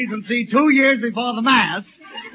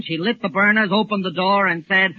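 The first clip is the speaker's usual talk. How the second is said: ordinary talk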